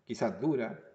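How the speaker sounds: ordinary talk, nothing marked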